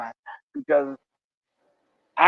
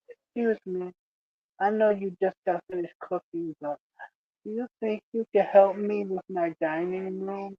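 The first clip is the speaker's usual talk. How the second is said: soft polite ask; 9 dB quieter than usual. Retold or imitated. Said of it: "and she'll go".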